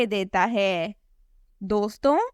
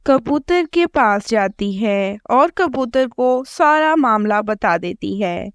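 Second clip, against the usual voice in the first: ordinary delivery, nothing marked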